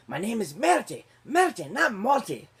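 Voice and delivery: loud, high fake Spanish accent; indignant protest in a put-on Spanish accent; Stanley Tucci's mock-Spanish accent. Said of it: "he's pretending he's Spanish".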